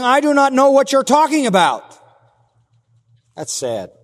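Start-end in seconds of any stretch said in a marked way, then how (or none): 0.0-2.0 loud, high-pitched insistence; raised voice, adamant disavowal; forceful denial. Acted. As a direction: deny it emphatically in front of everyone. Preacher acts Peter's denial.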